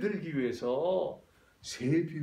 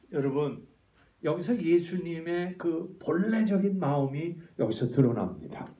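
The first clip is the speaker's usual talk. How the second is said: normal talking voice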